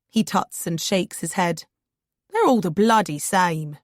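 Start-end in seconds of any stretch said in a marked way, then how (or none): 2.3-3.8 weary Cockney grumble, lightly voiced; a London cabbie tutting at politicians; Cockney accent. Cockney driver griping about politicians to his passenger. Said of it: "he says in a Cockney accent".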